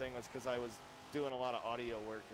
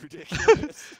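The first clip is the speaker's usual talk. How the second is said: laughing, high and loud; 20 dB louder, slower than usual. Real, not acted. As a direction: burst out laughing mid-sentence. Laughing at the failed build.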